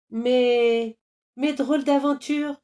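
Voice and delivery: put-on high-pitched little boy protesting; child's protest. A narrator's reading. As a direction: plead and object like a thwarted boy. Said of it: "protesta le petit garçon".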